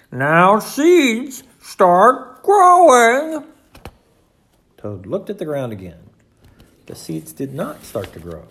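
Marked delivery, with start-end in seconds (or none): 0.1-3.4 high, very loud, drawn-out command; loudly. A narrator's reading. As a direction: call out slowly and forcefully. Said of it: "said loudly".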